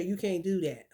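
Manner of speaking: low, soft forbidding; lightly voiced naysayer; mock forbidding. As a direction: drop low and deny it. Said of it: "They say".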